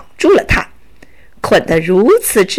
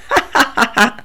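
mocking bandit laughter; robber's laugh; 3 dB quieter, faster than usual. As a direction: laugh at their faces, triumphant. (强盗得意的哈哈大笑)

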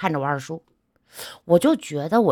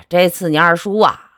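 ordinary talk

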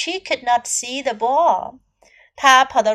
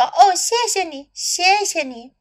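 cartoon princess; 6 semitones higher than usual. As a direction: gush with relief like a princess. Princess thanks in translation.

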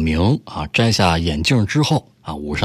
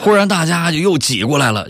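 normal talking voice

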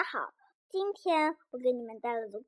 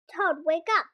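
loud call to wake someone; 4 semitones higher, 7 dB louder than usual. Acted. Frog calling Toad to wake up, loud call. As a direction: shout it up to a sleepy friend.

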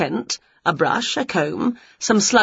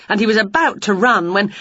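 usual speaking voice

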